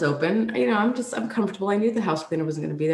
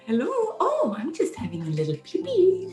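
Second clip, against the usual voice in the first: a hint of Swedish accent, pitched up; light Swedish accent; 9 semitones higher than usual. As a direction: cheerfully play the Swedish cleaner. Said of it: "a little bit of a Swedish accent".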